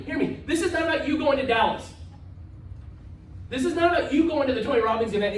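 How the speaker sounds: usual speaking voice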